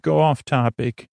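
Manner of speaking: ordinary delivery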